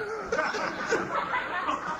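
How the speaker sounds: a burst of high giggles; giddy stoned giggle; high giggling laugh. Retold or imitated. Laugh at plunger joke.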